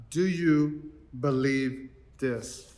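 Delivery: slow hushed question from the pulpit; solemn question. Acted. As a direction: ask it gently and let it hang. Jesus's question in the reading.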